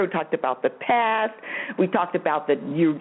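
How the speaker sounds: ordinary talk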